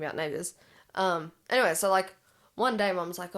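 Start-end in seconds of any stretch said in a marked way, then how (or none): none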